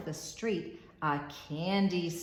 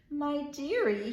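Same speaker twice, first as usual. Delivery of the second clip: lightly voiced old grocer, fond; a hint of a fussy shopkeeper calling her dearie; kindly grocer; 9 semitones higher than usual. Grocer telling Maggie she lacks money, lightly voiced. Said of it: "he said".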